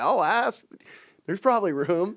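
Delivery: playful impression of a friend casually offering a spot; mock imitation of a friend. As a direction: toss it off like an easygoing buddy, hammed up. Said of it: "He's like".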